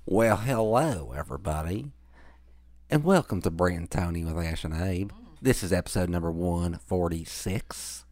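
Ralph Nader. Acted. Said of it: "It kinda switched into Ralph Nader".

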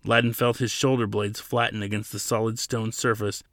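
ordinary delivery

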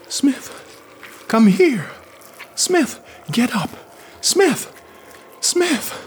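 high, urgent, commanding calls; commanding urgent hiss. A narrator's reading. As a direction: hiss his name to rouse him. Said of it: "Yarrow's whisper hissed in the silence, commanding, urgent".

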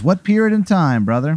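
high, swooping character question; lifted, familiar driver voice; quirky bus driver. Acted. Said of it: "He goes".

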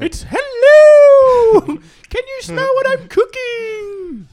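very high, drawn-out falsetto greeting; Mrs. Doubtfire parody. Acted. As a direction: hoot it loud and slow, hammed-up. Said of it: "Missus Dwayne Fire".

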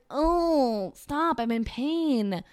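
mock agony at the bragging; exasperated. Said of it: "And it's like".

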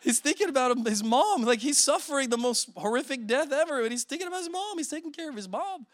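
high, eager retold text; a touch of breathless wonder; lightly voiced excited message; gushing to wife. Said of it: "I'm texting my wife and I'm like".

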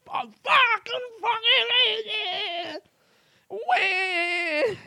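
high, strained mock wail of rage; mock tantrum. Retelling his tantrum over the wrong postcards, high mock wail. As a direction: throw a childish fit.

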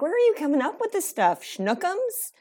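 hammed-up baffled questioning; mock disbelief. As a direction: squeal each question as if appalled. Acted disbelief at silly pet names.

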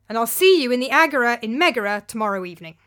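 curt parting shot. Acted. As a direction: fire it off and leave. Parting shot after throwing wine.

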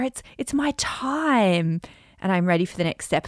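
hushed, secret affirmation; whispered. Shown as a demonstration. Coach demonstrating a whispered affirmation to listeners. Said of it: "you can emphasize different words".

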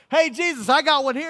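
high, loud call across a crowd; excited shout over the crowd. Acted. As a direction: holler to get someone's attention. Andrew calls out to Jesus.

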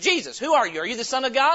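high, very loud questioning; shouted interrogation. Acted. Herod questions Jesus, loudly demanding. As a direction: fire questions at full voice.

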